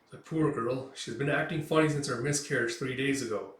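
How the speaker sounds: ordinary delivery, nothing marked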